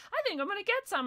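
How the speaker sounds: high-pitched, friendly shopper chit-chat; chirpy small talk. Retold or imitated. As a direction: make eager small talk with a stranger. Reenacting shopper's friendly reply.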